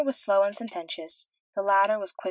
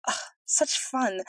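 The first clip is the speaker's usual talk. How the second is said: dreamy delight; 5 semitones higher, much slower than usual. A narrator's reading. Princess dreaming of being flown.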